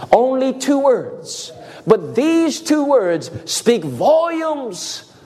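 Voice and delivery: very slow, high and drawn out; slow emphasis. Real, not acted. Preacher building up to the shortest verse, slow and high. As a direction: stretch each word for weight.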